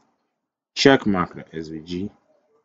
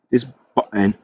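attempted British accent on "button". Shown as a demonstration. Coding tutor playfully trying his British accent on "button", British accent. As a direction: try out a British pronunciation of "button". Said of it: "let's try and do my British accent there".

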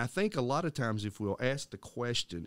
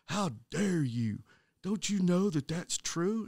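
mocking irate student; 9 semitones higher, slower than usual. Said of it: "He was absolutely irate".

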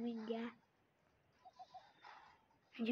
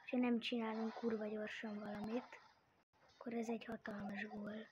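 whisper; 4 semitones lower, 11 dB quieter than usual. Real, not acted. Tense whispering.

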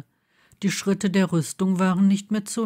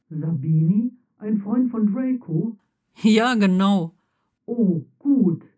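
armor voice; 2 semitones higher than usual. Acted. Armor voice in dialogue.

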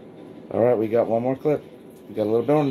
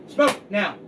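calling from far away; 8 semitones higher than usual. Real, not acted. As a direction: shout the dog's name across the yard. Calling dog from distance.

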